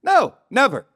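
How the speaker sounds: high, loud comic rejection; mock emphatic refusal. Larry refuses to watch the comedian's show, loudly. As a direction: slam the door on the idea.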